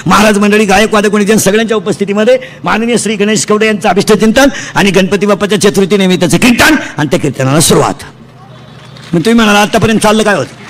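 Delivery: loud, full-voiced kirtan sermon; loud devotional preaching to the crowd. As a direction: preach out to the whole hall. Kirtan preacher addressing audience.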